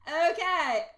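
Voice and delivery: loud high burst of laughter; real delighted laughter. Real, not acted. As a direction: laugh out, high, then settle. Laughing after finding a viewer.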